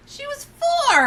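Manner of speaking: high, loud exclamation of disbelief; shocked outburst. Real, not acted. Shocked at child being questioned.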